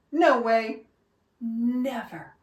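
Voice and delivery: ordinary delivery, nothing marked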